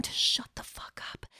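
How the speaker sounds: hushed, exasperated whisper. Real, not acted. Telling listeners about the noisy neighbours, whispered. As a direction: breathe it out, fed up.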